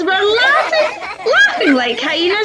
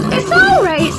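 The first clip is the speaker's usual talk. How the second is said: Ma Lion, voiced high and soothing; high motherly lioness reassuring; higher mother lion voice; 8 semitones higher, 3 dB louder than usual. A narrator's reading. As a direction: lift into a gentle mother's voice.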